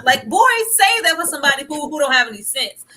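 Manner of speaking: loud exasperated telling-off; scolding. Real, not acted. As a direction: scold him like a fool. Scolding the man.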